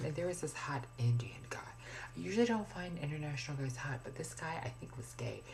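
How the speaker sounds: hushed gossipy whisper, mostly unvoiced; confiding whisper. Real, not acted. Gossiping about a gym guy.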